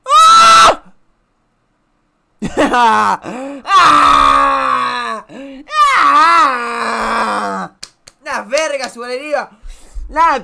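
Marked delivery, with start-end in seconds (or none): 0.0-0.8 high, loud sustained scream; sudden full-voiced shriek. Real, not acted. 3.7-7.7 drawn-out loud yells; long, falling screams. Real, not acted. Long screams after laughing.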